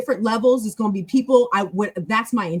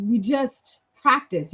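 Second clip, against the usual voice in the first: normal talking voice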